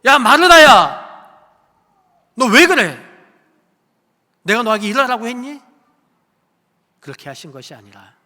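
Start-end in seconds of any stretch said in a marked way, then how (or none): 0.1-5.6 "야, 마르다야" shouted high and loud in a scolding voice; mock rebuke. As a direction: bark at Martha like an annoyed master, much louder. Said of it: "예수님은 책망하신 것이 아니에요". (크고 높게 꾸짖는 흉내)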